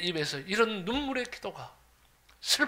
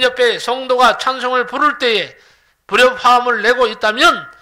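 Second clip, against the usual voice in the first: full-force preaching; 4 semitones higher, 12 dB louder than usual. Acted. Pastor urging his congregation to give thanks, preaching hard. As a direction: preach each line out to the pews.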